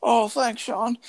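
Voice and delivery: old man impression. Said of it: "sounded more like the old guy".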